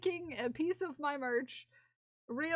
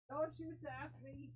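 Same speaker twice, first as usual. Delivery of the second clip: much softer, breathy mock scold; hushed mock scold; 13 dB quieter than usual. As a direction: hiss the scold under your breath.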